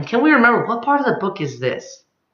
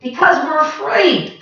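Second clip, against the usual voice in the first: high, a touch fearful creatures; timid chorus; 9 semitones higher than usual. Acted. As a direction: answer meekly as a group.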